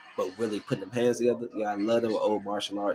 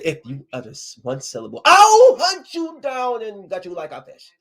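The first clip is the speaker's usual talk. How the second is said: Grinch threat; 10 semitones higher, 6 dB louder than usual. Retold or imitated. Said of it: "he said".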